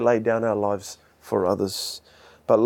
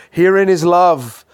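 proclaimed scripture; 5 semitones higher, 6 dB louder, slower than usual. Acted.

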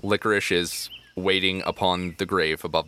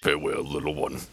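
deep, solemn creature voice; low creature farewell; 3 semitones lower than usual. Acted. Guardian creature parting from child, low and solemn. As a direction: bid goodbye low and gravely.

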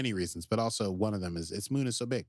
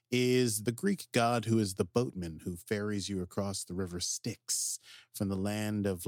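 usual speaking voice

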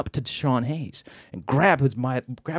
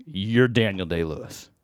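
low, slow, unconvinced statement; imitated deadpan doubter, pitched down; skeptical challenge; 6 semitones lower, 4 dB quieter, slower than usual. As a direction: say it slowly, not buying it. Sceptical husband is on to him.

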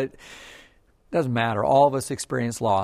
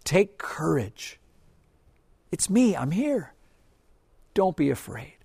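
gentle reassurance; 3 semitones higher, slower than usual. Acted. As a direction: say it close, like comforting a frightened friend. God's message, said gently.

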